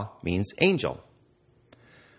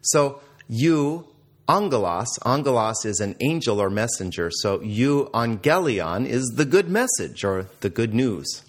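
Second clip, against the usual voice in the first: ordinary talk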